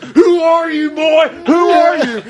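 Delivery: high shouted challenge; imitating Sid yelling; mock yell. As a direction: bark it like an angry wrestler. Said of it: "Sid's just like".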